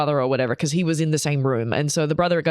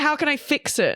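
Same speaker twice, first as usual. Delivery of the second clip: higher, lightly voiced helpless fixer; mock flustered man; 9 semitones higher than usual. Imitating a man facing someone crying, mock flustered. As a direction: send up an eager, useless fixer. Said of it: "also as a man, you're like".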